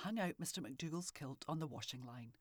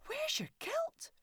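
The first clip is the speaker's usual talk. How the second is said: alarmed wife; 6 dB louder, slower than usual. A narrator's reading. Wife alarmed at missing kilt.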